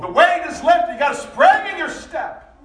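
preaching at full force. Acted. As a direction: thunder it out over the congregation. Preaching climax on burden lifted.

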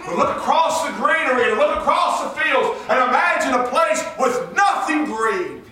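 high, loud sermon shout; full-force preaching. Real, not acted. Preacher urging church to imagine nothing green, full-force preaching. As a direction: preach it out, stretching the final words.

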